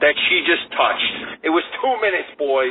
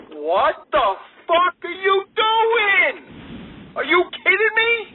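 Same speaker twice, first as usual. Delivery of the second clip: much higher, strained outraged yell; furious retold yell. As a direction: blast the neighbor in disbelief. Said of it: "I said to this lady".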